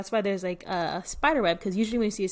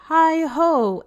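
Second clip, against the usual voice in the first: loud cheery call; 7 semitones higher, 6 dB louder than usual. Acted. Hare calls at the door.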